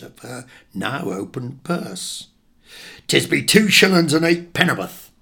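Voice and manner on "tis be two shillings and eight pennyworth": loud, higher-pitched Devon fishmonger; gruffly businesslike Devon accent; broad Devon accent. Acted. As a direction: announce the price in broad Devon. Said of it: "invariably say in his broad, deafened accent".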